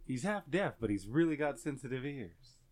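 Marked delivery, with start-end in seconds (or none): none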